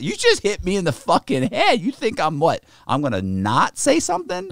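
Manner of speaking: high-pitched, incredulous comeback; indignant retort. Retold or imitated. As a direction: argue back in mock outrage. Retold indignant retort to Billy.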